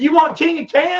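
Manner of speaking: high, loud booming question; hammed-up divine reply; mock voice of God. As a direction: answer a prayer with a big incredulous offer. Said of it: "He said".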